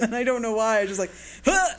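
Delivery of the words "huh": sudden high, loud startled huh. Retold or imitated. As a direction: jolt as if a buzzer went off. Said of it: "like someone hit a buzzer".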